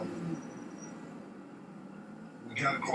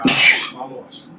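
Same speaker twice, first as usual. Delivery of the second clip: retold shout; 3 semitones lower, 11 dB louder, slower than usual. Retold moment during the chase.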